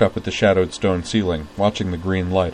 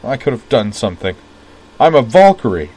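bitter insistence; 4 semitones higher, 9 dB louder than usual. A narrator's reading. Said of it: "he insisted bitterly".

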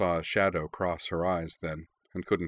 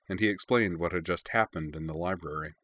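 usual speaking voice

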